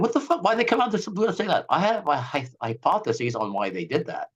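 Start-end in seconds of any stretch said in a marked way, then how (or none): 0.0-1.7 a high-pitched mock outburst of disbelief; stunned outburst. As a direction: squeal it out in baffled shock, the way everyone reacted. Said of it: "everyone was wondering".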